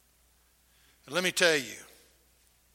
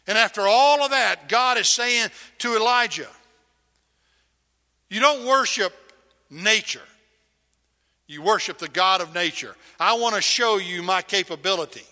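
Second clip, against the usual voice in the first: loud, high, thundering proclamation; preacher booming as God to Elijah; 5 semitones higher, 7 dB louder than usual. Acted. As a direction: proclaim it like God speaking from heaven. Preacher voicing God loudly.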